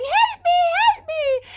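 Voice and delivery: high, loud squeaky pear crying help. Acted. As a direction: shriek it like a terrified little pear. Pears cry out in basket.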